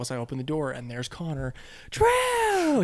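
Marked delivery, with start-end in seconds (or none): 1.9-2.8 squealed high and loud; a little kid thrilled to see his big brother; excited toddler. Retold or imitated. Toddler spots big brother at the door, squealing with joy.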